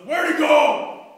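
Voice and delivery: slow, booming demand; loud, drawn-out furious question; angry shout. Acted. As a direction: bellow it while hunting someone down. Acted angry man hunting with knife.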